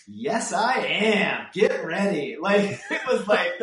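loud, high, defiant yelling; cocky young shout. Retold or imitated. Young writer shouting back at the producer, brash and loud. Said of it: "so young that I was like".

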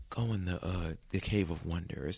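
soft, slow sinister command; quiet villain order. Retold or imitated. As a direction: murmur the order menacingly.

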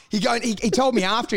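normal talking voice

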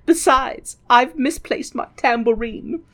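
mock weary refusal; McGonagall. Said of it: "McGonagall is like".

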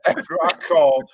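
cracking up at his own story; laughing. Real, not acted. Intern laughing while telling how he got blocked.

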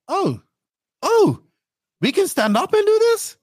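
retelling a thrilled discovery, pitch climbing high; excited disbelief. Retold or imitated. Retold excited realization.

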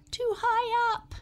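high, loud, startled gasp; a little girl gasping as the chair is too high; gasping strain. Acted. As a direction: squeak it out as she slips. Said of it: "she gasped".

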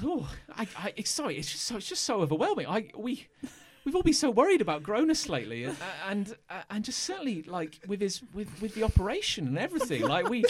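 high, fast gushing guard voice. Acted. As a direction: babble it out, high and excited. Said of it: "he goes".

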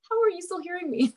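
high, mortified squeak; mortified disbelief. Retold or imitated. Overheard yet again by the voice actor, high mortified disbelief. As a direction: pitch it up in embarrassed disbelief.

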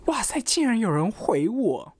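high, slow amazed exclaim; wow, someone actually answered. Real, not acted. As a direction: draw out the disbelief, pitched high.

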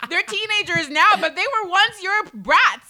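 loud, heated mock comeback; indignant retort. Older mom setting younger moms straight, loudly indignant. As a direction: build to a loud, emphatic clapback.

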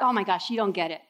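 low, quick, fed-up aside; mild mock exasperation; exasperated Jesus. Jesus answers Peter.

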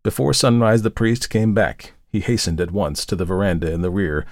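ordinary delivery, nothing marked

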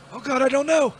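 exasperated outburst. Acted. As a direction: give up and yell it skyward. Gives up and curses Jesus.

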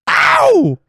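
high, loud pop yelp; [name] yelp. Pretend. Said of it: "And [name]".

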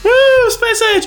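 high excited whoop; cheering. Real, not acted. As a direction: cheer him on loudly. Cheering for Space Age promo.

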